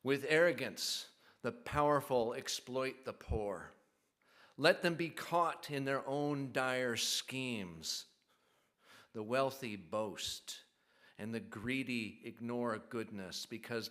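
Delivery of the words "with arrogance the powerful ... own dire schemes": forceful reading. Acted. Psalm reading, forceful opening.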